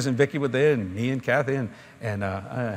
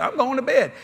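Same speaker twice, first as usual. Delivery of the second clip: going to bed; 8 semitones higher than usual. Retold or imitated. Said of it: "I just".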